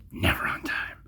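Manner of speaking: whispered aside, voiceless; a self-mocking whisper. Real, not acted. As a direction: breathe it as a guilty aside.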